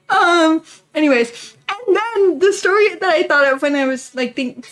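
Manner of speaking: ordinary talk, nothing marked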